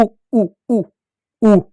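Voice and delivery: "उ" in brief separate repeats; clipped little "उ" vowels for pupils to copy; short उ. Shown as a demonstration. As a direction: say "उ" short, with a gap after each. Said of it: "क्या पढ़ते हैं?".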